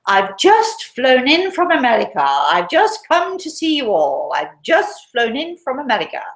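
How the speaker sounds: high, grand plum-in-the-mouth announcement; plummy cultured woman. Retold or imitated. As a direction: swan in with a loud, very posh English voice. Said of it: "a very cultured voice".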